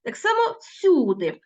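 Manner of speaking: ordinary talk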